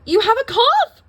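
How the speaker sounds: high, loud alarmed cry; shocked shout at the coughing doctor. A narrator's reading. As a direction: yelp it in alarm. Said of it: "cried Lucretia".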